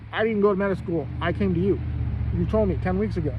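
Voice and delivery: angry retort. Retold or imitated. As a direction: hammer each line at him like an accusation. Arguing back at the doctor.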